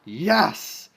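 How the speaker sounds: loud high cheer of relief; relieved cheer. Real, not acted. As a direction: cheer as if the story is over. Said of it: "Great way to end the story".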